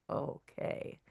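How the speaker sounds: breathless, barely voiced okay; stunned whispered okay. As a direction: whisper it in shock and defeat. Stunned reply to surgery news.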